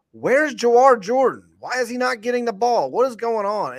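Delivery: high, loud exasperated fan yell; frustrated fans. Retold or imitated. Fans in the Discord demanding the ball, high exasperated yell. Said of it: "me and somebody else in the Discord were like".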